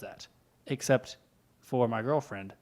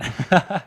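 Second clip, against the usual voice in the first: loud laugh; 5 semitones higher, 13 dB louder than usual. Real, not acted. Laughing at one bullet.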